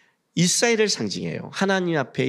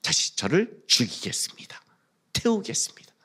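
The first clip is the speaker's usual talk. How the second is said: hushed, whispered vow to God; whispered prayer; 4 dB quieter than usual. Acted. Worshipper offering themselves to God as a sacrifice, whispered prayer.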